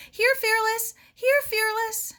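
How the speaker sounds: high ringing call to a missing dog. Acted. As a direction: call out for the dog far away. Calling lost dog outdoors.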